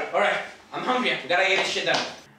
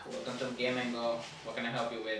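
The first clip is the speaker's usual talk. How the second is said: low, lifeless clerk voice; dead voice; 13 semitones lower, 13 dB quieter than usual. Acted. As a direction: sound completely bored and numb. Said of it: "Go back with my dead voice".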